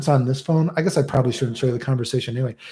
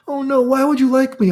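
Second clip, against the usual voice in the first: high-pitched imitation of a nervous girl; insecure girl; 12 semitones higher than usual. Starstruck girl doubting a streamer likes her, high imitated fretting. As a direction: squeal it as a self-doubting girl.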